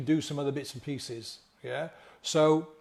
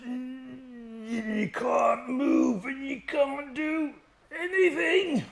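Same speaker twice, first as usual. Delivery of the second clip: frozen in overwhelm; 9 semitones higher, much slower than usual. Retold or imitated. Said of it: "like I just demonstrated".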